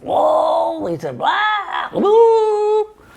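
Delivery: high, loud startled yelps; strangled squawks of fright; shocked croaking yelps. A narrator's reading. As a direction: yelp in panic as she lands. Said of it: "croaking sounds came out".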